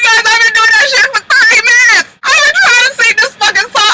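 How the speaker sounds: hammed-up wailing, high and loud; fake-sob shouting the complaint; mock crying. Mock-crying rant about singing.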